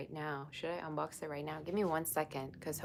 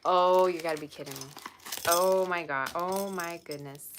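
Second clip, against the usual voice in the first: loud delighted disbelief; 8 dB louder than usual. Real, not acted. Opening the next gift.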